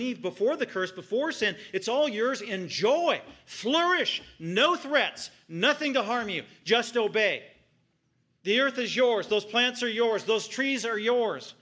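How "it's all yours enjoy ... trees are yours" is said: high and loud, generous and exultant; joyful proclamation. Retold or imitated. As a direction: announce the gift with delight. God speaking to Adam and Eve.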